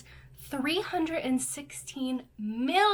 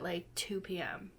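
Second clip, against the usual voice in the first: whispering; 4 semitones lower, 9 dB quieter than usual. Real, not acted. Confessional aside.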